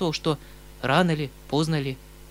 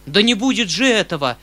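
loud, higher defiant shout; 6 semitones higher, 10 dB louder than usual. A narrator's reading. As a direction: shout it and stamp your foot. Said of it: "вскрикнул дядя".

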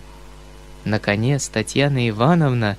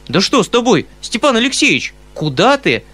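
high, loud startled call; astonished cry; 9 semitones higher, 4 dB louder than usual. Acted. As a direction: cry out in bewilderment. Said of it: "вскричал изумлённый дядя".

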